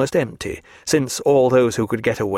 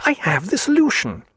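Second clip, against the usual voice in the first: much higher, eureka cry; sudden idea; 11 semitones higher than usual. A narrator's reading. Stephen cries out as the answer strikes. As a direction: it comes to him all at once.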